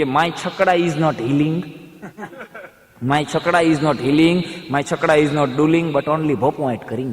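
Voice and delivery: put-on slow, halting Gujarati-accented English; broken village English. Rickshaw driver explaining the breakdown in slow broken Gujarati English. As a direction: grope for each English word slowly. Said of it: "અંગ્રેજી શરૂ કરી".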